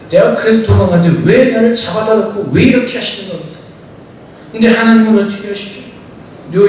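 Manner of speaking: normal talking voice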